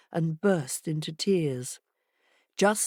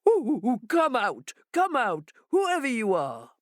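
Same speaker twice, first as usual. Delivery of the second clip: shout; 6 semitones higher than usual. A narrator's reading.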